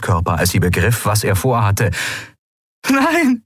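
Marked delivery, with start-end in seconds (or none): 2.9-3.4 high-pitched cry of protest; desperate scream. A narrator's reading. Andriel realizes what Keru intends, screaming. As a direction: cry out high to stop him. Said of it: "schrie sie".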